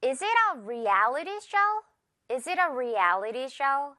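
slow question, "reality" stressed and stretched on "al"; stress on middle syllable. Shown as a demonstration. Teacher reading an example question, "reality" with a long stressed "al". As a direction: lengthen the "al" in "reality". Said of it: "L 부분에 우리 강세와".

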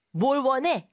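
unsmiling demand. Shown as a demonstration. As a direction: demand to know, no friendliness. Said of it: "방실방실 웃으면서 하는 말 같진 않죠".

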